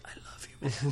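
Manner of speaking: breathy whispered confession. Retold or imitated. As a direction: whisper it close, all breath, no voice. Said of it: "and he's like".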